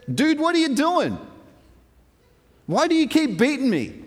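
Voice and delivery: high-pitched aggrieved protest; complaining donkey. Retold or imitated. Donkey turning to Balaam after being beaten, complaining. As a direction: whine up high as the wronged animal.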